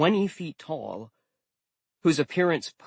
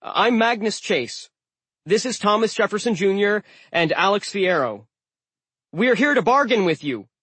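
forced confidence; 8 semitones higher, 6 dB louder than usual. A narrator's reading. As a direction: fake it bold over fear. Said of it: "hoping I sounded more confident than panic-stricken".

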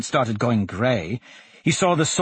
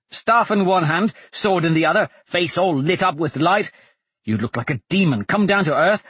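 excited; 6 semitones higher than usual. Acted. Blaze boasting about the charge.

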